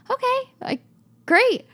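very high-pitched, awkward acceptance; high mock-agreeable voice; taken-aback reply. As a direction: act out a squeaky, thrown-off reply. Acts out reply to owner's refusal.

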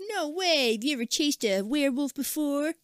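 put-on high sassy kid voice; cheeky girl voice. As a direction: fire back like a cheeky girl. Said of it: "she's like".

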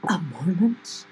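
breathy stage whisper of disbelief; loud whisper. Acted. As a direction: whisper it back in mock disbelief. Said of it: "I'm gonna very loudly whisper".

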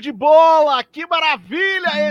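radio-style goal celebration, slow and booming; excited goal call. Acted. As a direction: belt it out like a radio narrator. Host cheers after goal clip.

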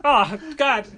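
high, loud exasperation; mock a dad bothered by visitors; put out. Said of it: "He's always so put out".

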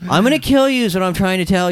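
sweetly threatening in a high voice; friendly villain voice. Acted. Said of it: "I like to really talk high".

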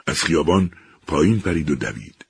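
usual speaking voice

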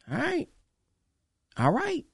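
high, soft, knowing "all right"; sarcastic all right. Real, not acted. Speaker teasing viewers who mocked the brothers, sly and high. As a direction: float it up, teasing. Ironic aside to mocking viewers.

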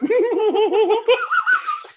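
high, loud burst of mock laughter; imitated burst of laughter. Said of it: "She just went".

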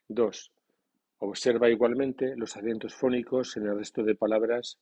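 ordinary delivery

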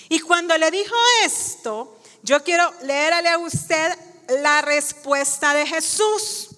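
ordinary talk, nothing marked

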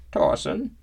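put-on high, cracking old woman's voice; cracked old voice. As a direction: crack the voice with age and tiredness. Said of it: "Gilly's voice cracked with age".

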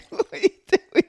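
usual speaking voice

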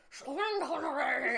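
slow, loud Scottish Connery voice with a gargle; Sean Connery impression. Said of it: "a Sean Connery, like, kinda thing".

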